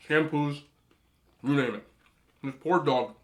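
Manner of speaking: usual speaking voice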